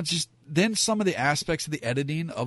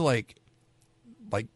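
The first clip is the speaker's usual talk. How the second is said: ordinary delivery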